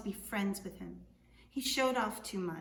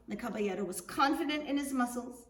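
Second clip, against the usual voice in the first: ordinary delivery, nothing marked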